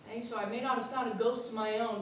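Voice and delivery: ordinary talk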